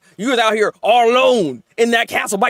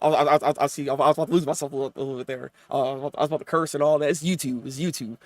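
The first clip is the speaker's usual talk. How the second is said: fast and softer, reining himself in; catching himself before cursing, quieter and quick; calming down; 8 semitones lower, 7 dB quieter, much faster than usual. Real, not acted. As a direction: pull it back and hush yourself. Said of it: "Let me calm down".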